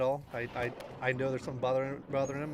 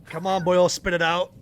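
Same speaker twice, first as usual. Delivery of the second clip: loud taunt; 6 semitones higher, 10 dB louder than usual. Acted. Teasing in-character taunt.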